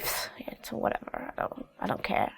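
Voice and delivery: breathy, careless whisper; dismissive whisper. Shown as a demonstration. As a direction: brush it off under your breath. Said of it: "It's more like".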